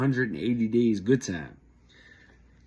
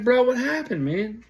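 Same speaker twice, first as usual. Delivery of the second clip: eager surprise; 13 semitones higher, 3 dB louder than usual. Retold or imitated. Friend says he quit kitchen.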